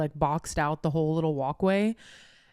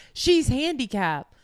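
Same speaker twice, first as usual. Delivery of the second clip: retold indignant protest; 6 semitones higher, 5 dB louder, slower than usual. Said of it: "He goes".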